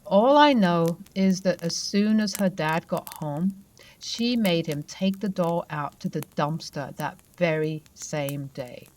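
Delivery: ordinary delivery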